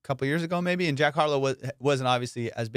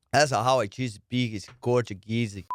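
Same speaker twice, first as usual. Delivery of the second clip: usual speaking voice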